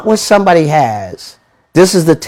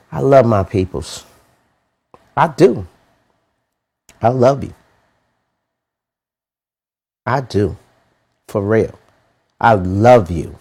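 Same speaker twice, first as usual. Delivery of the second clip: quieter, tender declaration; soft and sincere; 6 semitones lower, 3 dB quieter than usual. Real, not acted. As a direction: speak gently to your people. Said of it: "That's why I'm talking like this".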